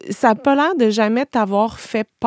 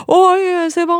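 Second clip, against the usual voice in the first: oui enjoué et spontané, plus aigu; enthousiaste; 8 semitones higher, 3 dB louder than usual. Retold or imitated. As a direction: imite un oui enthousiaste, sans hésiter. (enthousiaste et plus aigu)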